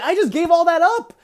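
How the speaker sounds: high, loud, exasperated retelling; indignant protest. Retold or imitated. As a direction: protest like a disciple in disbelief. Disciple protesting guru's order.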